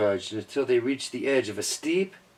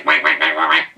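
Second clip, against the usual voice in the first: duck quacking; 4 semitones higher, 7 dB louder than usual. Acted. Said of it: "Duck's all like".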